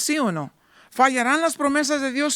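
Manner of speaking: usual speaking voice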